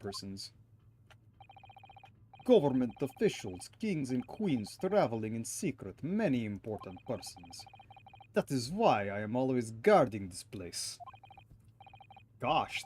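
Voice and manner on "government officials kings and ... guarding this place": put-on gruff, proud Russian accent, a touch louder; huffy Russian-accented sailor. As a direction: play a stubborn Russian crewman. Voices Russian sailor character.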